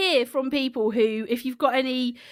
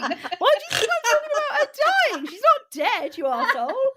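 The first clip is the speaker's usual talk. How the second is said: mock outraged; 8 semitones higher than usual. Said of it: "what if they go".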